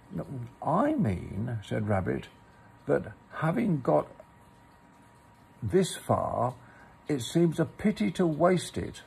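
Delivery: story narration. A narrator's reading. Reads a Pooh story aloud.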